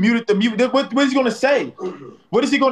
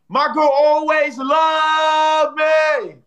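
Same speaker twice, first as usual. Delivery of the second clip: proud shout; 5 semitones higher, much slower than usual. Real, not acted. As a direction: stretch it out like a victory call. Celebrates a supporter.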